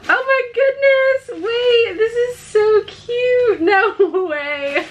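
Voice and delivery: high squeal breaking into laughter; delighted amazement. Real, not acted. Vlogger opening another baby gift, squealing with delight. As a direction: squeal in happy disbelief.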